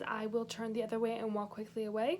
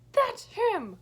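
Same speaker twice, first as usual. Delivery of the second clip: higher, louder urgent "That's him."; 11 semitones higher, 8 dB louder than usual. Retold or imitated. Girl urgently points out the creep to Joe. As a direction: push it out in alarm. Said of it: "I'm like".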